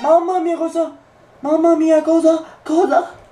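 high incredulous exclamations; surprised. Real, not acted. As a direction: gasp out in disbelief. Near miss reaction.

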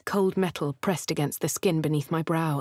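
ordinary talk

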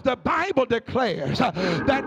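rhythmic whooping sermon, each phrase hammered out over the organ; preaching at full force. Acted. Preacher urging the congregation to sleep and trust God, full whooping sermon. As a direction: rise into a sung preacher's cadence and drive it home.

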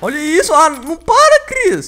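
loud alarmed shout. Real, not acted. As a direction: yell it in panic. Horde keeps coming.

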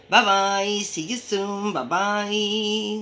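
high, held sing-song notes; sing-song goodbye. Real, not acted.

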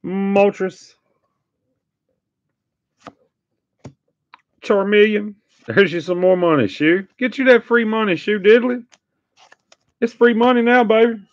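hyped card pulls. Real, not acted.

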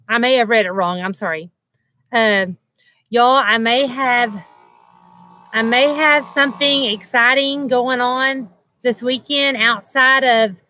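usual speaking voice

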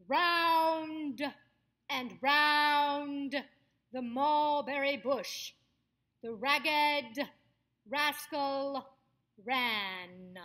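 loud and drawn out, open mouth on each word; projected stage voice. Shown as a demonstration. Coach demonstrates a stage exercise for actors, loud projected voice. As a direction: project to the back of the house, opening up the final sounds. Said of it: "sound projects to the back of the house".

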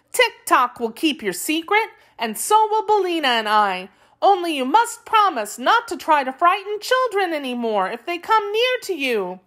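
put-on high-pitched storybook girl; earnest young girl. A narrator's reading. Dorothy making the Wheeler promise, earnest high girl's voice. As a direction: reassure and bargain in a high girlish voice.

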